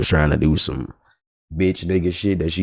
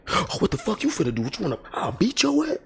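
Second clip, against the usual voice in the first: imitating angry customer; 14 semitones higher, 6 dB quieter, faster than usual. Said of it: "she hit me with the".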